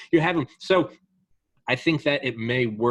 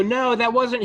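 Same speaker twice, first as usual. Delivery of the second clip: high, loud mocking reply; a squeaky protester brushing it off; voicing protesters, hammed-up; 11 semitones higher, 5 dB louder, slower than usual. Protesters voiced in retold clash.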